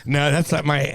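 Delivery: ordinary talk, nothing marked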